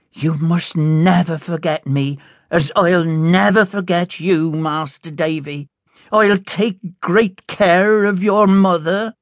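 Peggotty légèrement jouée, plus haute et tendre; fond nanny voice. A narrator's reading. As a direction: une nounou aimante, voix un peu plus aiguë. Peggotty's voice in story.

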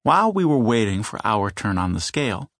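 plain reading voice

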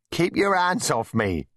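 high, drawn-out playful protest; grinning. A narrator's reading. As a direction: protest slowly through a grin.

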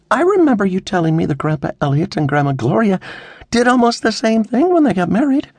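high, insistent reasoning; earnest, mildly defensive argument; arguing. A narrator's reading. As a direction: argue your case to Dad. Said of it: "Veronica argued".

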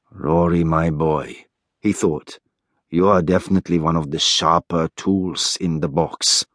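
low, dry aside to himself; Teo, wry inner thought. Acted. Teo silently sizes up young Rory, wry inner thought.

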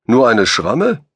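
"Schramme" thrown back with a rising, disbelieving tune; wounded man echoing the giant's "nur eine Schramme" in mock disbelief; incredulous echo. A narrator's reading. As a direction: repeat his words as if they were absurd. Kosum echoes incredulously.

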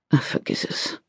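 breathy whisper. Acted. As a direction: whisper it away, embarrassed. Dismisses embarrassing dream.

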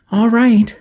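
afraid. A narrator's reading. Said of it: "her eyes wide and afraid".

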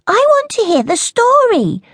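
high, loud snap at her sister; angry little sister. Acted. Sister snaps at sister.

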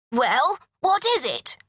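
a touch of an impatient king; king crying out. A narrator's reading. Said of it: "he cried".

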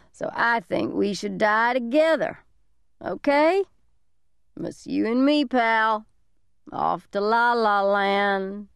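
put-on a deranged drunk talking to a kid; drunk man voice.

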